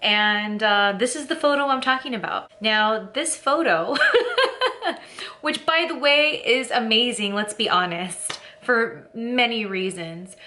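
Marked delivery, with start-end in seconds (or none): 4.0-5.4 high giggling burst of laughter. Real, not acted. Vlogger laughing at her own photo, giggling burst.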